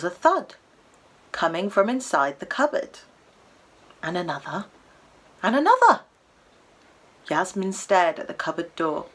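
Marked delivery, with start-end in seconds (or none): 4.0-4.7 soft, questioning lift at the end; hushed suspense. A narrator's reading. Narrator counting a second thud from the cupboard, hushed suspense. First of two knocks, building suspense. 5.4-6.0 much higher and louder, falling at the end; startled escalation. A narrator's reading. Narrator as the thuds from the cupboard build, startled escalation.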